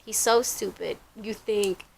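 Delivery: soft teasing under the breath; quietly mock him between laughs; laughing quote. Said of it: "We're like".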